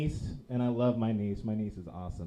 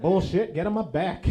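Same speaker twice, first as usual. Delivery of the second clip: loud, raised-pitch mock indignation; indignant outburst; 7 semitones higher, 9 dB louder than usual. Uncle outdone by Uncle Travi, loud and indignant. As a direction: burst out, stung by the comparison.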